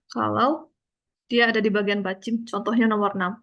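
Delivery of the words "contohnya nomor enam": ordinary talk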